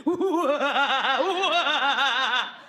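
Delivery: high wavering mock howls; wobbly ooh-ah. All of them gone giddy after the treatment, wavering ooh-ahs. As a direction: draw out quavering, spooky oohs and ahs. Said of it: "and we're all going".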